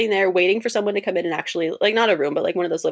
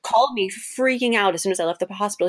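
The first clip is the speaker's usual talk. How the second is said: normal talking voice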